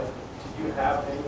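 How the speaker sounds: usual speaking voice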